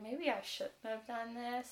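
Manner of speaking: soft, slow second thoughts; mock remorseful. Captor has second thoughts about the princess, mildly remorseful. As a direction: imitate someone quietly regretting it, taking it slowly. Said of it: "he starts feeling bad".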